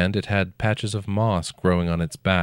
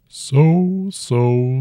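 slow, rumbling ancient dragon; 7 semitones higher, 7 dB louder than usual. A narrator's reading. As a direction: speak as a huge old dragon, unhurried. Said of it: "the dragon said".

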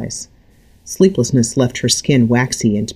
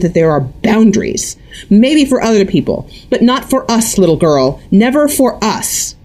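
high, loud overbearing tirade; possessive mother ranting; 9 semitones higher, 4 dB louder than usual. A narrator's reading. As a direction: refuse her any boundaries.

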